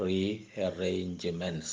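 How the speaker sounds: "rearrangements" drawn out very slowly, re-ar-range-ments; syllable by syllable. Shown as a demonstration. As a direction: sound out each syllable of "rearrangements" separately. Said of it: "Many syllables we can see".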